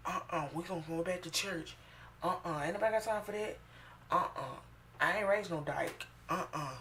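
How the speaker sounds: a hint of a stern mother saying no. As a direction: play the disapproving mom refusing it.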